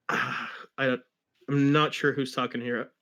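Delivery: ordinary delivery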